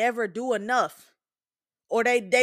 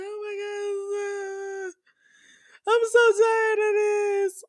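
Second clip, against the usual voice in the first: high, wailing mock sob that breaks into a shout; imitating a crying friend; 10 semitones higher, slower than usual. Friend calling in tears about her son, imitated with a high wail. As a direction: play the overwhelmed mom falling apart on the phone. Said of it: "she called me crying".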